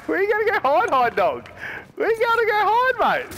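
high-pitched taunting call. Real, not acted. Gamer asks an opponent where to hide, taunting. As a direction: crow it at a cornered opponent.

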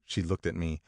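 plain reading voice

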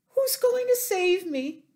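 high, loud urgent question; crying out. A narrator's reading. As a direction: plead to know, as a frantic piglet. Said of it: "cried Wilbur".

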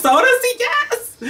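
rising into a very high squeal; thrilled squeal. Real, not acted. Thrilled at the teaser scene.

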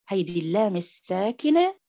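usual speaking voice

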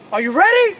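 loud, high call to the team; shouting. Real, not acted. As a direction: shout it out to fire them up. Coach calls out to team.

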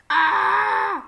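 high, loud shocked cry; loud high alarmed aah; startled shout. Real, not acted. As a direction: yelp as the car is hit. Sudden crash, shout heard.

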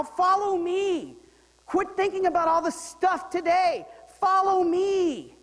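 high, loud and slow, stretched out; fervent preaching. Acted. Preacher voicing Jesus' call to the crowd, fervent. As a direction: plead it with full force.

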